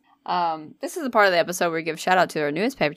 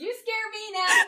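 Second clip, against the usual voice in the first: high, slow whimpered squeak; scared squeal; slower than usual. Real, not acted. Friend protesting a false scare, whimpering high.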